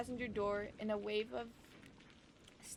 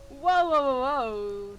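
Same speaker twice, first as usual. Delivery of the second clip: loud objection; 3 semitones higher, 13 dB louder, much slower than usual. Acted. Defending himself mid-bickering, loud protest. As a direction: cut in loudly to halt the accusation.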